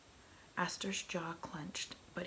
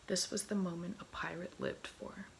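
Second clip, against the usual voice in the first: ordinary delivery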